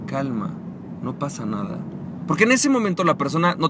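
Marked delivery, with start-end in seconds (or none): 0.1-1.8 soft, slow soothing calm; mock zen calm, softer. Shown as a demonstration. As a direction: play it overly zen and serene. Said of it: "con una energía calma y zen".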